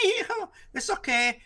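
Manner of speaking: high, slow, giggly mock reassurance; squeaky put-on laughing voice; imitated laughing. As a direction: mimic someone laughing through soothing words. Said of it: "she starts laughing".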